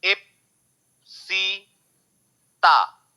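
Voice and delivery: "Ipsita" very slow, in three separate pieces; long pauses between the syllables of "Ipsita"; broken into syllables. Shown as a demonstration. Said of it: "छोटे-छोटे हिस्से में जब उसका sound को आप तोड़ते हो".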